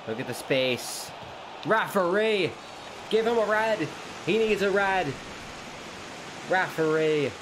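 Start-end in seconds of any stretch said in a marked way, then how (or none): 1.7-7.3 angry appeal. Real, not acted. Gamer yelling at the referee over a foul, angry shouting. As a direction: demand a red card at the top of your voice.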